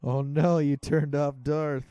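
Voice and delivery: loud, slow cry of alarm; host reacts as the Vader clapper cuts the light, in loud dismay; real dismay. Real, not acted. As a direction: blurt it out as the lamp goes dark. Clapper toy shuts the light.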